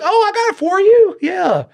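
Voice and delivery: very high, sugary mock-helpful voice; mocking sweet voice. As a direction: play it up with a sickly sweet high tone. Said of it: "And you're like".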